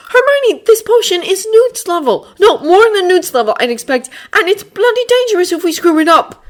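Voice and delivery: put-on high, loud haughty boy voice; Draco voice. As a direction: promise it with a sneer.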